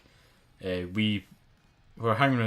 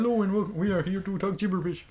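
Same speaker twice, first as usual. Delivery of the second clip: high-pitched puppet host voice; Kermit the Frog; 10 semitones higher than usual. Acted. Suggesting a show intro to co-host, high Kermit voice. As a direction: greet the audience as a high, silly puppet. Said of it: "do the intro and Kermit the Frog".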